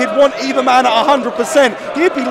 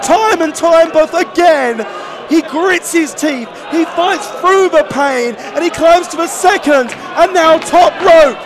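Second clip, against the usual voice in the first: loud, building play-by-play call; wrestling commentator; 4 semitones higher, 3 dB louder than usual. Real, not acted. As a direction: build to the big splash. Commentator calls the climb and splash.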